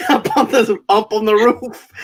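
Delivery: words breaking up with laughter; giggling while retelling the funny bit; laughing. Real, not acted.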